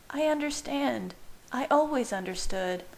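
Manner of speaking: high, calm reassuring woman; soothing. A narrator's reading. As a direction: gently reassure. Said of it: "Bella said soothingly".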